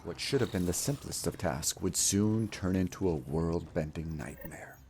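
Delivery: grand, measured fairy-tale narrator; epic story narration. Acted. Streamer narrating his game run as legend. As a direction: tell it like an ancient legend.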